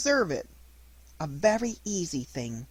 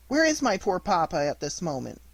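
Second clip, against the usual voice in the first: usual speaking voice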